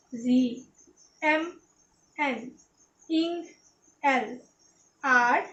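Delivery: each letter name spoken slowly and clearly, one by one, with a pause after each; reading letter names aloud. Shown as a demonstration. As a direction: spell out the shorthand letter names slowly for the class to copy. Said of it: "আমি একবার পড়ছি, তারপর তুমি পড়বে". (ধীরে ধীরে স্পষ্ট করে প্রতিটি অক্ষরের নাম উচ্চারণ)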